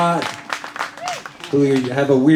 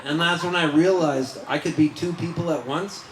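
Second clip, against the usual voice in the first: ordinary delivery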